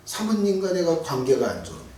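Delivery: ordinary talk, nothing marked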